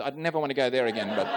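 quick aside that breaks into a laugh. Real, not acted. Preacher joking to the congregation, fast with a laugh. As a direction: toss it off with a chuckle.

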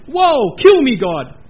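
loud, high "kill me"; anguished cry. Acted. Preacher asking the congregation, loud dread cry. Mock despair before God.

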